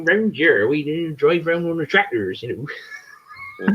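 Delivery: hammed-up southern English farmer; southern English farmer accent. Shown as a demonstration. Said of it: "I can do a bit of the farmer".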